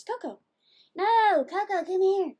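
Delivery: high, loud call to the dog. Real, not acted. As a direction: call the dog back firmly. Calling the dog.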